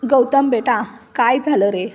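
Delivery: plain reading voice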